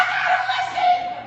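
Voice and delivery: high, loud shout with a laugh; excited shout. Retold or imitated. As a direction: burst it out in disbelief. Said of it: "talking to your spouse".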